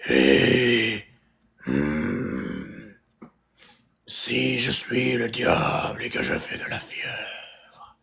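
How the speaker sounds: very slow, low, soft vampire voice; movie vampire. Shown as a demonstration. Vampire threatening to suck your blood, low slow creature voice. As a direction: draw it out low like a film vampire. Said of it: "une voix de vampire dans les films".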